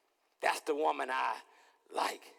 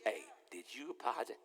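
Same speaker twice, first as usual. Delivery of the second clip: quiet nudge; 7 semitones lower, 11 dB quieter than usual. Acted. God waking him at night.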